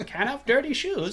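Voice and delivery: high, lilting leprechaun voice; a prissy little leprechaun fussing. Retold or imitated. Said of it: "That's my leprechaun, uh, impression".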